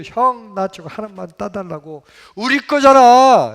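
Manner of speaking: acted-out insistent child; pleading child. Child begging hyung for a persimmon.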